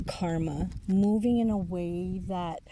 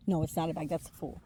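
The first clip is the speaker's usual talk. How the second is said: normal talking voice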